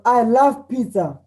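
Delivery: slow, high and loud with delight; joyful. Shown as a demonstration. Teacher showing a student's joy about pizza. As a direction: savor each word with joy. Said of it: "that shows your joy".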